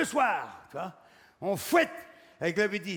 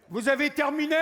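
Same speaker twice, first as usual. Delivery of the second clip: higher, louder waiter question; mock waiter call; 7 semitones higher, 6 dB louder than usual. Waiter clearing the plate, higher, louder mock query. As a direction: pipe up like an eager waiter.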